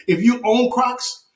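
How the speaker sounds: high-pitched, indignant shouting; worked-up comic tirade, voice way up; passionate rant. Real, not acted. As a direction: let it rip, outraged and incredulous. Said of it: "I just have to be passionate".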